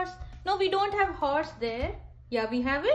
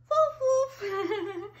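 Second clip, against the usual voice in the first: playful dog bark; 5 semitones higher than usual. Shown as a demonstration. Mother imitates dog, laughing.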